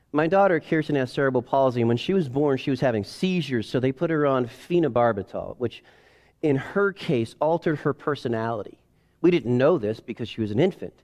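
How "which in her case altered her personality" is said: normal talking voice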